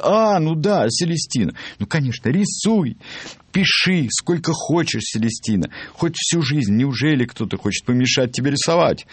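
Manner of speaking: high, animated burst of realisation and indignation; sudden coming-to. A narrator's reading. Chief dentist suddenly remembers Celestine and champions her, high excited outburst. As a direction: voice jumps up, exclaiming and demanding. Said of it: "И вдруг словно очнулся".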